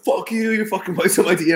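high, quick mock trash talk; McGregor's helpless taunting. Fan mimicking fighter on the ground, mock defiant. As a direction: imitate a downed fighter still mouthing off. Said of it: "laying on the ground, like, helpless".

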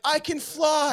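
deluded boast. Retold or imitated. Quoting deluded guy at window.

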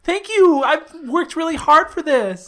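high, loud, weepy thanks; tearful joy. Pretend. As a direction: gush with overjoyed tears. Role-play, gushing thanks.

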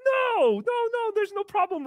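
squeaky high no no no; high squeaky reassurance. Acted. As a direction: shoot pitch up to calm him.

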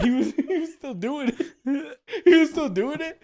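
high-pitched, breaking into laughter; laughing while telling. Real, not acted. Friend telling how he kept doing it, laughing. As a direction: tell it through real giggles.

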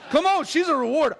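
ordinary talk

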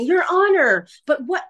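mock flustered interjection; imitated interrupting. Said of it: "he's trying to talk over her".

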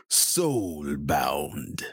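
deep, booming fighting game announcer; Mortal Kombat announcer. Acted. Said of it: "my best Mortal Kombat announcer voice".

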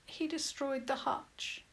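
high, soft and hollow; small, hollow girl's voice. A narrator's reading. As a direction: speak softly, emptied out by loss. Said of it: "she spoke in a hollow voice".

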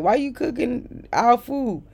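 high, drawn-out mock complaint; mocking imitation. Imitates housemate's complaint about food.

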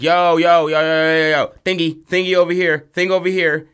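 high, loud call to the others across the room; loud, high "come see this" shout; excited shouting. Acted. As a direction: yell urgently to get everyone to look. Jock calls others to creature.

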